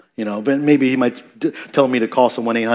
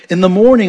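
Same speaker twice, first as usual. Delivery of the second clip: "In the morning" raised in pitch and volume; emphasis on MORNING; 6 semitones higher, 7 dB louder than usual. Acted. Preacher repeating the psalm's opening phrase, emphatic on "morning". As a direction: punch out "In the morning", high and loud. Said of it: "you notice the emphasis on the morning".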